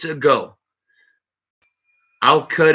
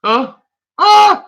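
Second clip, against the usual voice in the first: sudden high, loud yelp; startled yelp; 9 dB louder than usual. Real, not acted. Vlogger yelping as the razor cuts his goatee, startled. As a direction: jump at the first cut.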